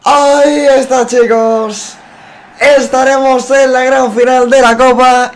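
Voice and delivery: slow, loud, high victory call; triumphant announcement. Real, not acted. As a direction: announce the win proudly. Cup final celebration.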